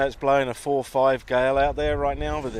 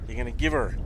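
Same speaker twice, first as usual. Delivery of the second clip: put-on Canadian accent. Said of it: "That's my Canadian accent".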